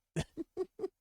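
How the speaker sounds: squeaky rising giggle; delighted squeal; high laugh. Real, not acted. Laughing after innuendo.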